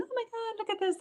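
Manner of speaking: very high, soft squeal of retold excitement; excited. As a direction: squeal it softly, high above your own voice. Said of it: "just so excited, going".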